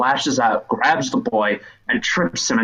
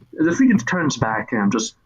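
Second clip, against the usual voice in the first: normal talking voice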